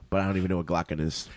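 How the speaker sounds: ordinary talk, nothing marked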